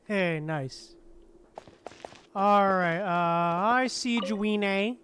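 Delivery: ordinary talk, nothing marked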